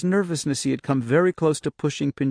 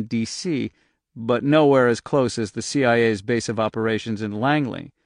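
ordinary delivery, nothing marked

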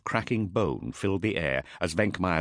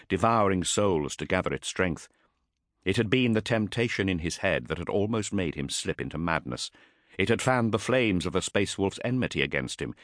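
ordinary delivery

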